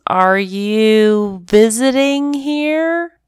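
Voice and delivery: slow, high kitten voice. Acted. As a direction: ask like a curious kitten. Kitten asking the dog.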